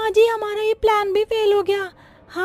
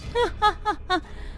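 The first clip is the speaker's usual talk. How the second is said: giggle; 9 dB quieter than usual. Acted. Bahu laughs at mother-in-law.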